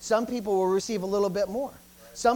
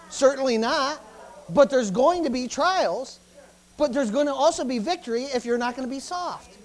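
fiery preaching; 3 semitones higher, 4 dB louder than usual. Acted. Preaching at full force.

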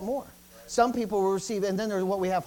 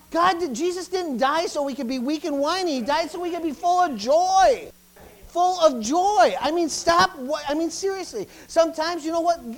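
much higher and louder, hammering it home; fiery preaching; 7 semitones higher, 6 dB louder than usual. Acted. Preaching at full force.

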